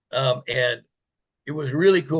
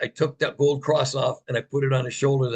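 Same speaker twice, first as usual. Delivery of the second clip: normal talking voice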